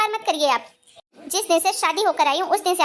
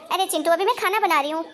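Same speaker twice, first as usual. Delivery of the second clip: ordinary talk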